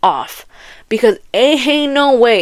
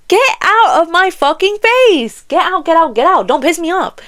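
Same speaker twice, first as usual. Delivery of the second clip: higher-pitched, exasperated telling-off; angry outburst; 6 semitones higher than usual. Real, not acted. Ranting at fantasy book characters, angry outburst. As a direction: send them packing, high and heated.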